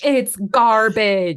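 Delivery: slow, drawn-out mock correction; mock American insistence. Friend joking the word is garbage, slow and insistent. Repeats slower in put-on voice.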